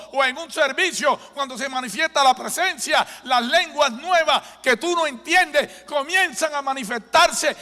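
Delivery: very high, loud fervent preaching; preaching at full force. Real, not acted. Pastor testifying to the congregation, fervent full-force preaching. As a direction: lift the crowd with it.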